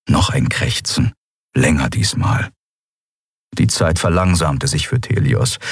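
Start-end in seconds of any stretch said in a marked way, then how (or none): none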